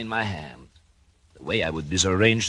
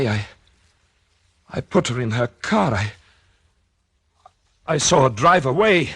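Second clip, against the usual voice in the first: panicked; 4 dB louder than usual. Acted. Caught man protests in panic.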